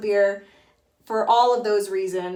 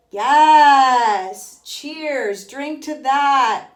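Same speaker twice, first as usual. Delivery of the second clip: loud, happy toast; excited cheer; 4 semitones higher, 9 dB louder, much slower than usual. Retold or imitated. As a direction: raise a glass and cheer. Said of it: "And I was like".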